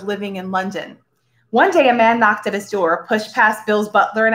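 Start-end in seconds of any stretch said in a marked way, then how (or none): none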